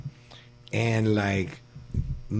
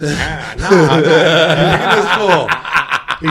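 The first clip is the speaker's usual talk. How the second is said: louder, laughing through the words; 7 dB louder, much slower than usual. Real, not acted. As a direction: crack up while teasing him. Laughing at friend's face.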